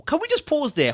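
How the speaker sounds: high, loud break from reading; excited interruption. Real, not acted. Reader halting the story to react, excited. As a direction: stop the story, fired up.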